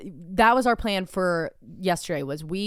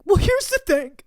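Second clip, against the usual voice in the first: high, loud mocking voice; partner impression; 12 semitones higher, 6 dB louder than usual. Retelling partner's comeback, high mocking voice. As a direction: mimic him in a squawky high pitch.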